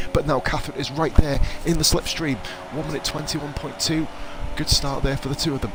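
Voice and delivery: excited live race call; motorsport commentator. Acted. Live race commentary, raised pitch.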